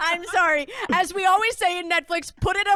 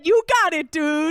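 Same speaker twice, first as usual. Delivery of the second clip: catchphrase imitation; 4 dB louder than usual. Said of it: "She said".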